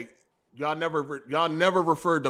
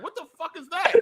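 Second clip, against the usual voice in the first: surprised; 9 semitones higher, 6 dB quieter than usual. Retold or imitated. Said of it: "I was like".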